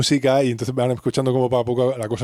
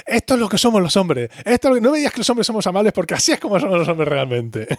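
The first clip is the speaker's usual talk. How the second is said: Ross lecturing; 7 semitones higher than usual. Retold or imitated.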